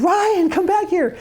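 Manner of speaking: high, slow sing-song call; drawn-out beckoning call. Retold or imitated. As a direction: call him back across the camp. Woman calling him over.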